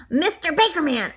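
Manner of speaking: plain reading voice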